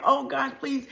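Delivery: high, urgent begging; panicked pleading. Acted. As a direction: plead to get away fast. Frightened child pleads on bus.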